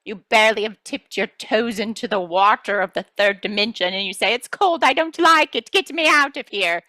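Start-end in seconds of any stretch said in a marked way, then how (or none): 4.3-6.8 high, whiny complaint; a child begging to leave; whining child. Pretend. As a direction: whine like a child stepping into cold water. Voicing a complaining star seed.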